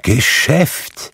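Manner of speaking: high exclamation, rising in disbelief; indignant cry. A narrator's reading. Ghost throwing Scrooge's word back, crying out. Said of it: "rief das Gespenst".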